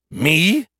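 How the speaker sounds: high indignant squeak; offended, disbelieving. A narrator's reading. Skippy indignant.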